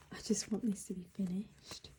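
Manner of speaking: ordinary talk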